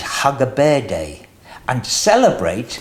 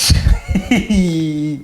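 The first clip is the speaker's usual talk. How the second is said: laughter. Acted. Sheep laugh in food fight.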